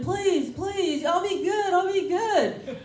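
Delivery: high, pleading imitation of a worn-out kid; hammed-up pitiful begging; mock whimpering. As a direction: beg for mercy in a put-on whimper. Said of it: "he's just whimpering, he's panting".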